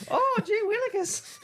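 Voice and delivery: very high, slow squeaky quote; exaggerated high puppet impression; mock co-host voice. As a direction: drawl it out high and silly. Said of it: "you're doing me".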